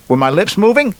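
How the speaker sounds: high, odd little monkey voice. Acted. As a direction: squeak it out like a cheeky monkey. Monkey's punchline in joke.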